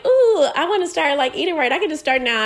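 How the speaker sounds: excited self-talk about dieting; eager resolve. Retold or imitated. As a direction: sound keen to start eating right. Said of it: "I was like".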